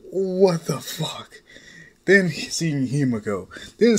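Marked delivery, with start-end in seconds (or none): 0.1-1.4 stunned curse. Acted. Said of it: "Then he's like".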